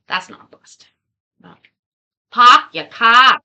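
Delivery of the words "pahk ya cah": loud, slow Boston "pahk ya cah"; attempted Boston accent. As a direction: drop the r's, Boston style, punched loud. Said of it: "say it like they would say it".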